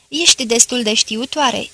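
usual speaking voice

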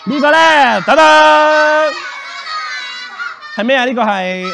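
very high, loud ta-da; showy reveal. Real, not acted. Magician shows children the next picture, excited fanfare. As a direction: call it out like a big unveiling.

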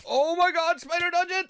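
high, loud shriek of alarm; panicked scream. Real, not acted. Startled by a spider dungeon.

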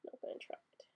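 a quick voiceless whisper to herself; whispered aside. Real, not acted. Aside while skipping German word.

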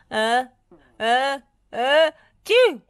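high, loud build-up into an explosive sneeze; mock sneeze that bursts at the end; acted sneeze. A narrator's reading. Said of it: "he sneezed".

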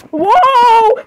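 high, drawn-out whoa; startled cry. Real, not acted. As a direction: cry out in shock, pitch leaping up. Startled by game event.

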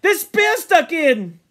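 high, loud thrilled cry; frightened, proud excitement. Acted. Ralph boasting of hitting the boar, excited shout. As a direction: shout it out, breathless with pride. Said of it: "full of fright and apprehension and pride".

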